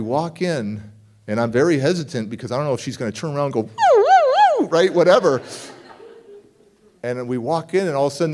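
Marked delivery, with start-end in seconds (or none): 3.6-4.7 wild high woo woo; high loud mock crazy hoots. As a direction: hoot like a lunatic. Imagined crazy noise.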